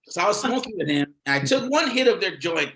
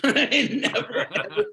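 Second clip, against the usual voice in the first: laughing; 10 semitones higher, slower than usual. Real, not acted. Laughing at punchline.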